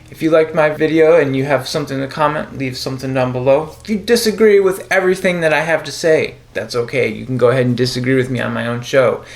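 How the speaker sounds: bright announcer voice signing off the show; show host sign-off. Acted. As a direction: wrap up the episode like a cheerful show host.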